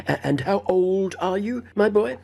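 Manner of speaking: a hint of a prying old host; eager old gentleman. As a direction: press the boy again with odd eagerness. Abney asks the age again.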